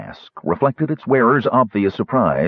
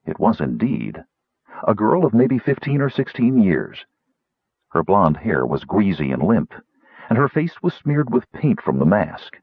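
plain reading voice

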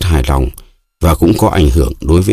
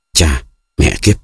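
annoyed curse. A narrator's reading. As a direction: swear in exasperation at the mess. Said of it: "khoát tay bực bội". (bực bội chửi thề)

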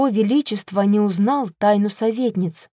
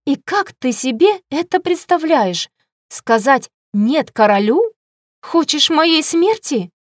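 higher, indignant rhetorical questions; angry outburst; 5 semitones higher, 4 dB louder than usual. A narrator's reading. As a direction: throw the questions at him in indignation. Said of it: "Я так разозлилась". (возмущённо, сердито)

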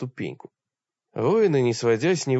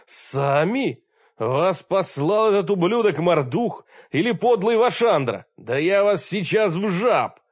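higher, louder, raging tirade; furious threat; 6 semitones higher, 4 dB louder than usual. Acted. Furious wizard threatening the intruders, raging shout. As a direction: threaten to turn them into toads.

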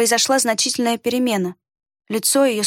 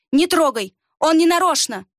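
urgent plea; 6 semitones higher than usual. A narrator's reading. Girl shields boy from attacker.

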